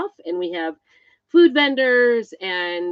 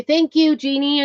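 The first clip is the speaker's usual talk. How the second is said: higher and louder, delighted thank you; happy thanks to a viewer; excited thanks; 5 semitones higher, 7 dB louder than usual. Real, not acted. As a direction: beam out the thanks to Jeannie.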